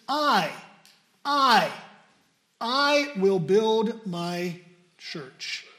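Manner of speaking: I, I, I hammered then slowly I will build my church; emphatic repeated I. Shown as a demonstration. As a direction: drive home the I, very slowly. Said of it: "But Christ says".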